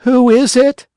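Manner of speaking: the puppet calls to the door; sleepy call. A narrator's reading. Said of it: "he called".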